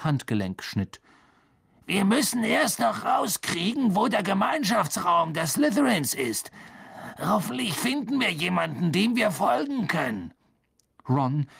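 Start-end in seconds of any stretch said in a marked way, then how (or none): 1.9-10.3 put-on higher-pitched boy figure, lively; raised character voice, thinking out the next step; Harry planning urgently. A narrator's reading. Harry tells Ron they must find the common room, higher and urgent.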